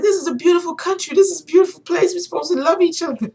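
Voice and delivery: high, hurried impassioned plea; imitating passionate man. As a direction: act out his worked-up appeal. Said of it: "he was so passionate about what he was talking about".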